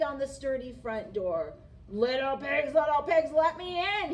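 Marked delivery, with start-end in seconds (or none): 1.9-4.1 loud wolf growl. A narrator's reading. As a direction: growl it loudly at the door. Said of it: "he growled".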